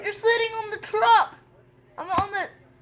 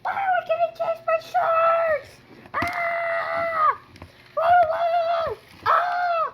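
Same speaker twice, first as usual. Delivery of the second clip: high panicked screams; panicked screaming; 7 semitones higher, 4 dB louder, much slower than usual. Pretend. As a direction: scream in playful terror. Chased by sharks in game.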